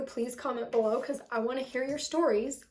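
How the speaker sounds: usual speaking voice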